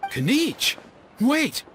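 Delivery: high, startled rise into a sharp stop; sudden realization. Acted. Father startled to hear the guests know Kinich, high and sudden. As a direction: catch yourself mid-thought.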